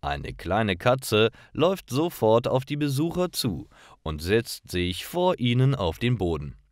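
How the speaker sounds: ordinary talk, nothing marked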